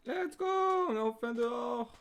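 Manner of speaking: loud, high, triumphant shout; real burst of excitement; excited cheer. Real, not acted. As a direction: cheer it out, pitched well up. Cheers at getting outside.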